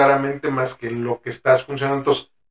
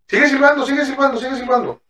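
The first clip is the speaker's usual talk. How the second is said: higher, insistent repeated urging; 11 semitones higher, faster than usual. Retold or imitated. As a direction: push him to keep whistling over your voice. Therapist urging patient to whistle. (le insiste más agudo que siga silbando)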